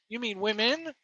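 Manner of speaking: normal talking voice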